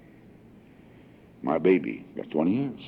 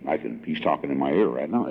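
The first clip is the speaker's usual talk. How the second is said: normal talking voice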